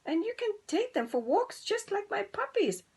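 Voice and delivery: very high, excited dog voice; barking dog. Acted. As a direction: bark it out cheerfully. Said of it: "barked Daisy".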